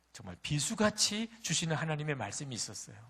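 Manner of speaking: usual speaking voice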